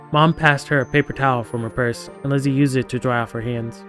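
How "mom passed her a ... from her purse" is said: ordinary talk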